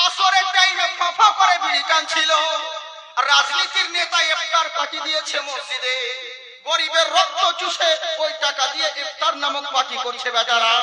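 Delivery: loud, rising sermon cries, pressing each line; full-force preaching. Acted. Preacher rousing the Friday crowd, loud and fiery. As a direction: thunder it at the crowd like a village waz preacher.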